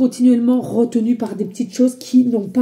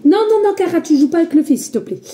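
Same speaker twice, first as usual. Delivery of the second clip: scolding a pet; 6 semitones higher, 3 dB louder, faster than usual. Real, not acted. Scolding dog with cable.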